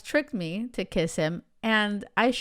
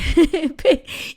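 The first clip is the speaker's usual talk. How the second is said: high burst of laughter; 8 semitones higher, 7 dB louder than usual. Real, not acted. Laughing at retold scene.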